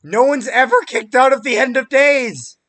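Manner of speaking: high, loud stunned yell; excited shout. Real, not acted. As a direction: yell it in total disbelief. Stunned at a wrestling upset.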